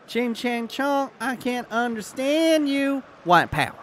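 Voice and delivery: high, loud, slow hammed-up skit quote; exaggerated sketch-character voice; comedy skit impression. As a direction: re-enact a sketch, stretching the words out. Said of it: "Classic skit from Dave Chappelle".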